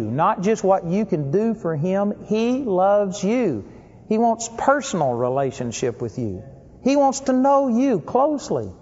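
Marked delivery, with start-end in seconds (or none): 2.3-3.6 drawn-out slow. Shown as a demonstration.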